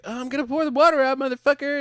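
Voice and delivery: high, fast cocky voice; mock hero voice. Voicing Beck in retelling.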